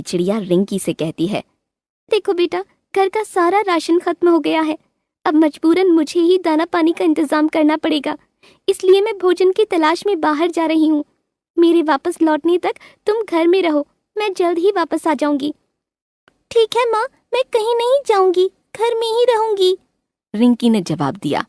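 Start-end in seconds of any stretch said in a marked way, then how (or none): 2.1-15.5 high gentle mother bird instructing her daughter; high-voiced mother bird explaining to her daughter. A narrator's reading. 16.5-19.8 put-on very high childlike bird voice; little bird girl. A narrator's reading.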